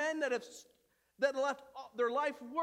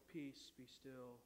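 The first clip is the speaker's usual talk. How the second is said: soft, slow and somber; calm the sea gently, like the Sunday school version; 11 semitones lower, 17 dB quieter, slower than usual. Retold or imitated. Flannel-graph Jesus calming the storm, soft and slow. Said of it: "Jesus always very calmly".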